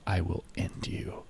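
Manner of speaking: breathy whispered threat, half joking; joking threat to chat, whispered; mock menacing whisper. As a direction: hiss the threat low and close. Threat about losing money.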